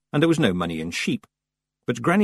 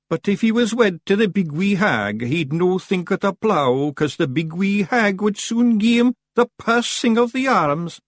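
high, eager Scottish figure; Daft Willie reasoning; 11 semitones higher, 4 dB louder than usual. A narrator's reading. Daft Willie arguing for a wedding, eager innocence. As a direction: rattle on naively, pitched well up.

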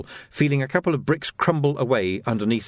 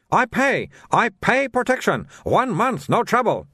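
high-pitched, sing-song sales patter in broken English; haggling like an eager shopkeeper; pushy shopkeeper Soon; 10 semitones higher, 3 dB louder than usual. Acted. Soon voiced again.

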